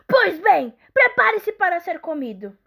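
loud, high threatening troll. Acted. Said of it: "disse o troll".

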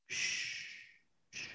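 whispered shush; shushing the crowd. Retold or imitated. Wrestler shushing the crowd, whispered. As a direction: hush them with an unvoiced shh. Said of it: "he starts going".

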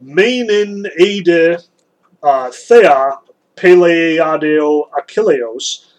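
very slow, measured reading of "Meinen eide, thea peleiadeo Achilleos"; reciting Homeric Greek. Shown as a demonstration. Teacher reading the Iliad's opening line aloud, slowly and deliberately. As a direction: sound out the Greek syllable by syllable, each vowel drawn out. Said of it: "So in that first line of the Iliad".

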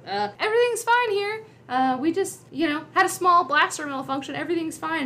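high-pitched, hesitant mock reassurance; flustered bluff. Han bluffing guards over the intercom, flustered and high. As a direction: stumble through an unconvincing all-clear.

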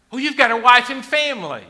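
a pained shout over wife and family; anguished cry. Real, not acted. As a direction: let the loss break out loud. Climax of stripping list.

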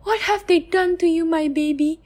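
high, pained lament; anguished mother. Acted. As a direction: grieve over your child.

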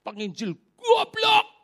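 high, loud, slow sneering shout; angry insult. Retold or imitated. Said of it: "dia bilang". (makian keras bernada tinggi)

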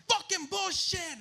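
high slow furious shout; angry yell. Retold or imitated. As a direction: curse the call. Yelling at refs again.

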